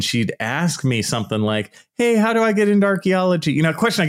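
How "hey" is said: ordinary talk, nothing marked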